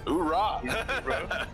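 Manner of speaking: laughing shouted oorah; oorah battle cry. Pretend. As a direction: bark it like a soldier. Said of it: "can I get a oorah?".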